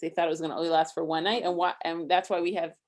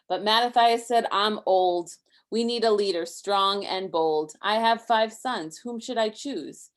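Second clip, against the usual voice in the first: lively rhyming read-aloud, a bit higher and louder; story reading; 5 semitones higher, 6 dB louder than usual. A narrator's reading. Parent reading a Hanukkah book to Isaac, lively rhyme. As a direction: read the rhymes to a child with bounce.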